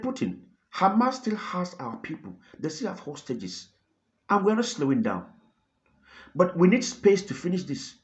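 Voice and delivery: half-acted, worn out yet cutting; tired but sharp. Israeli leader answering the Russian president, tired but sharp. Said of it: "he sounded so tired but sharp".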